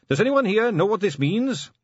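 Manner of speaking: high, loud call to the room. Acted. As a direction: raise the voice across the office. Asking the whole room.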